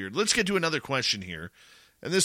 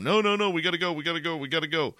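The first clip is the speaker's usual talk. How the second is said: higher, rapid-fire mock panic; imitated frantic buddy; panicked urging; 5 semitones higher, much faster than usual. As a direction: rush the words out, desperate to flee. Acts panicked buddies fleeing.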